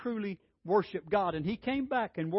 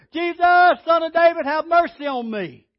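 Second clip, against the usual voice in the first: much higher and louder desperate plea; crying out louder; 9 semitones higher, 10 dB louder than usual. Retold or imitated. Blind beggar shouting to Jesus over rebukes, desperate yell. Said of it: "He cried out the more, even louder".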